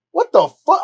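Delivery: loud outburst leaping up high; retold flare of outraged disbelief; hot, indignant. Hearing he is accused of ratting, hot indignation. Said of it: "I was hot".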